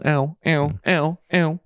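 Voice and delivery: lightly acted ow ow ow; faking pain. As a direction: mimic a sore wrist, mildly. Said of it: "my wrist is still not great".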